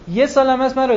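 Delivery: emphatic burst. Real, not acted. Sudden emphatic burst mid-sermon.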